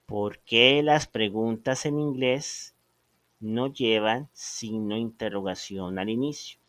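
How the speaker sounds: slow, deliberate repeat of the question; slow, careful repetition. Shown as a demonstration. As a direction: ask it again slowly so they catch it.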